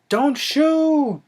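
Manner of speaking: high-pitched plea, stretched out; imitated panicked yell; mock cry. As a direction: call out in hammed-up alarm. Acts out Arnold's cry.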